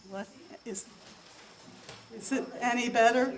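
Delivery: ordinary talk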